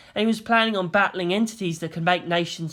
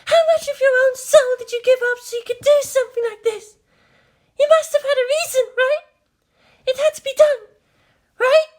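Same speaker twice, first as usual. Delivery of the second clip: put-on high, pressing young woman's voice; pleading Maya; 7 dB louder, faster than usual. Maya pressing Shinji for a reason, high and pleading. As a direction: urge him to give a reason.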